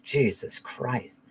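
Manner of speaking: slow, softened mock disbelief; retold dismay. Father reacting to his son's news, quiet retold dismay. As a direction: let it out slowly, under the voice.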